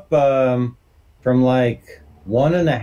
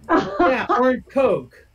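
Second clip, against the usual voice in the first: mock customer voice; 11 semitones higher, much slower than usual. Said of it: "And I'm like".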